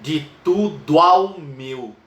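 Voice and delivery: scanning the verse slowly; syllables counted out. Shown as a demonstration. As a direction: count each poetic syllable aloud.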